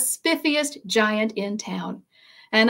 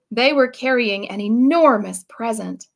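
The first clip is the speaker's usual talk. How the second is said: usual speaking voice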